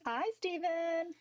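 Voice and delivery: high, slow, bubbly greeting; sing-song cheerleader hello; chipper cheerleader voice. Pretend. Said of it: "The chipper cheerleader voice".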